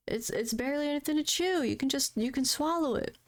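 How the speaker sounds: mock patronizing nurse voice; condescending nurse. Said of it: "the nurse was very condescending".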